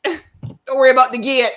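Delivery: grandma's reassurance dissolving into giggles; laughing grandma voice. Retold or imitated. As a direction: repeat granny's words while bursting into laughter. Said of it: "my grandma ... is just like".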